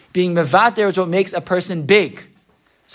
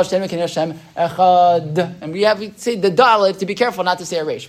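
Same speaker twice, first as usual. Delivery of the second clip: ordinary talk, nothing marked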